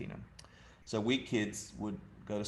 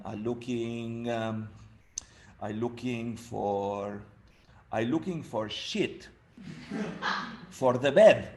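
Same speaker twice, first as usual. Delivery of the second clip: put-on mum's Argentinian accent; 4 dB louder, slower than usual. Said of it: "And she's like".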